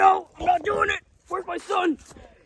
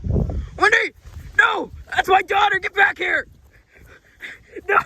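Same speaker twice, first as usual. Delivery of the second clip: frantic shouting; 5 semitones higher than usual. Acted. Dad yelling after his wandering daughter, frantic shout. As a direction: scream her name, panicked.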